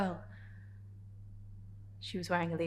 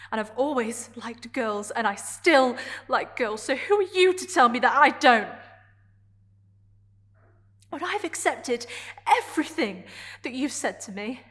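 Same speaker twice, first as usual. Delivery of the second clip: defiant outburst; 14 semitones higher, 6 dB louder than usual. Acted. Character confronting Adrian about who she is, defiant. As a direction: build to a loud, higher-pitched challenge.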